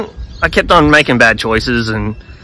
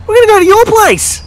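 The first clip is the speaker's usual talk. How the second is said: Jesus, cheerful; 4 dB louder than usual. Retold or imitated. Jesus inviting Russell down from the tree, high cheerful. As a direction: beckon him down like an old friend.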